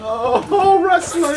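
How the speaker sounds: thrilled it's a wrestling question; excited. Real, not acted. Player delighted at a wrestling question, excited loud cry.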